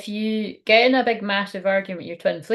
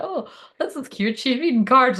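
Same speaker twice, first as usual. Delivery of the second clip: high, light mock viewer; lilting high quote of a casual viewer; imagined newcomer; 5 semitones higher than usual. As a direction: play a pleased newcomer, pitched up. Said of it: "you're like".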